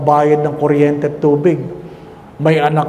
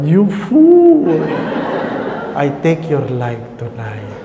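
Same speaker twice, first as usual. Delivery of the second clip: God's stern rebuke; slower than usual. Retold or imitated. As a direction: thunder it as the Lord, half laughing. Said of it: "Sabi ni Lord".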